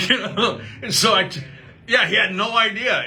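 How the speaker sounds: real laughter, amused; laughing. Real, not acted. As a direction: laugh at dad's cluelessness. Laughing at the story.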